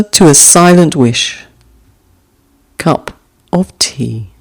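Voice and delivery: gentle recital of a short poem; poem reading. Acted. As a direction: let each image settle before the next. Reads haiku aloud twice.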